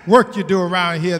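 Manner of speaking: ordinary talk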